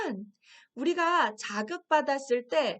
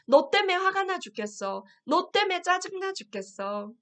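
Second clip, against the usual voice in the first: imitating irritated people; 7 semitones higher, 5 dB louder than usual. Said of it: "이렇게 말하는 사람들이 있어요".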